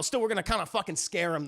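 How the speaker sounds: usual speaking voice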